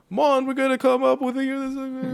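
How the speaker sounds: lightly put-on Jamaican accent, teasing; mock Jamaican accent. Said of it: "this, like, fall Jamaican".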